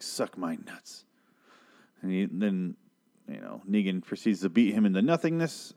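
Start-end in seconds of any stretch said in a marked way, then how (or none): 0.0-1.0 low-key quiet, slow defiance; quiet slow insult to the killer; defiant mutter. Retold or imitated. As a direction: mutter it like you don't care. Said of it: "and he's like".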